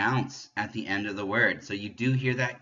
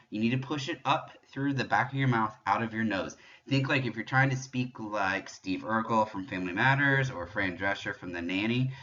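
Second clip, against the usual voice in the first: ordinary talk, nothing marked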